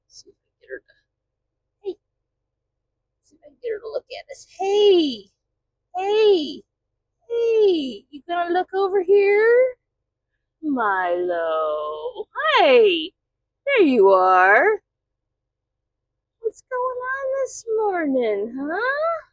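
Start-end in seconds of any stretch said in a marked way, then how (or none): 1.8-9.8 high-pitched call to the dog; sing-song hey to get her to look; high pet voice. Real, not acted. Calling up to the dog on the dashboard in a high voice. 12.6-19.3 high, slow cooing to the pet. Real, not acted. Owner cooing to the sleepy dog in a high voice.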